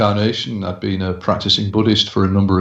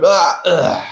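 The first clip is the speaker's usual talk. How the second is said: dying gasp; 7 semitones higher than usual. Retold or imitated. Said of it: "suddenly he just went".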